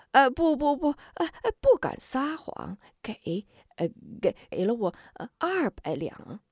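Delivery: high frightened stammer dropping to a near whisper; kowtowing in panic while confessing; terrified stammering. Acted. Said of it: "许仁吓得赶紧磕头".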